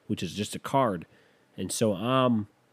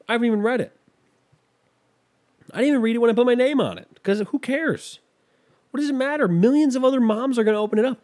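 building exasperated rant, faster and higher; worked-up comic rant getting higher and louder; rising rant; 9 semitones higher, 5 dB louder than usual. Real, not acted. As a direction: pitch climbs high and louder, rushing. Ranting about card buying.

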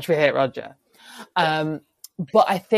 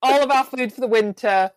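higher, louder, laughing eager grab; mock greedy chief; 7 semitones higher, 5 dB louder than usual. To the Englishmen, the chief laughing and shouting for more boats. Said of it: "the Mohawk would go".

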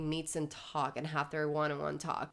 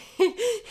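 loud squealing laugh; real shriek of laughter at the scene; high burst of laughter; 13 semitones higher, 9 dB louder than usual. Real, not acted.

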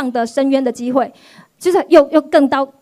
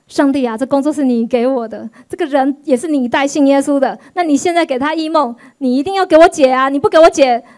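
louder pleading prayer; louder, begging cry to God; desperate plea; 4 dB louder than usual. Retold or imitated. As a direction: beg God urgently, on her knees. Retold desperate prayer on her knees.

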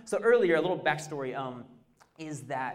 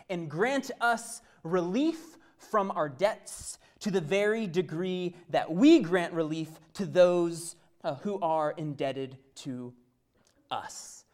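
scripture reading; 4 dB louder, slower than usual. Acted. Reads scripture verse.

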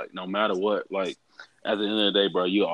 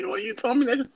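much higher, disbelieving; incredulous question; faster than usual. Retold or imitated. Quoting himself to his friend, a high incredulous question. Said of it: "I was like".

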